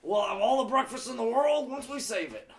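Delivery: high, loud cheerful hero; chipper high voice. Acted. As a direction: beam it out like a cartoon hero. Bright chipper character.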